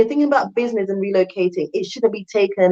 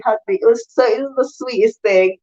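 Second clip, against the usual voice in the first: ordinary talk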